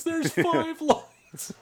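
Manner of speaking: very high, very slow anguished confession; tortured prisoner. Retold or imitated. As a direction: break down, stretching every word. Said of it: "like that scene from nineteen eighty-four".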